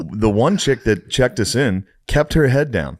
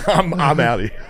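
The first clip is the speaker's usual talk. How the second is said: laughing exit; 7 semitones higher than usual. Retold or imitated. Laughing while retelling exit.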